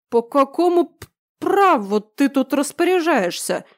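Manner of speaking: slurred, tongue-tied outrage; drunk slurring. A narrator's reading. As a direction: stumble drunkenly over the words. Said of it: "заплетающимся языком". (пьяно, заплетающимся языком)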